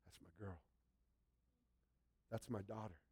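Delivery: proud, tender father; tender acknowledgement. Acted. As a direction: claim her gently as his own. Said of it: "and acknowledge".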